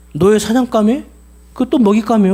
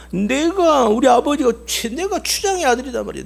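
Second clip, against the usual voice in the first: higher, defiant ranting slave; proud angry rant; 7 semitones higher than usual. Acted. Slave boasting of his chief father to the buyer, angry rant. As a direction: hurl it at him, a chief's son insulted. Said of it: "막 욕을 퍼부어대는 거야".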